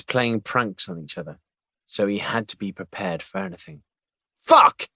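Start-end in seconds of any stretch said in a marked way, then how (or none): 4.4-4.9 very high, loud burst of a curse; shouted curse. Acted. Agent discovering bird poop, shouting a curse. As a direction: explode at the mess on his jacket. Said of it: "he shouted".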